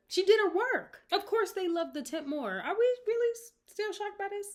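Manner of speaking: high, loud incredulous rant; exasperated. Real, not acted. Host defending the temp to listeners, exasperated.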